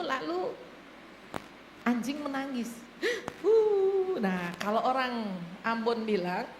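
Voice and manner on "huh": startled gasp. Retold or imitated. Startled by dog howling.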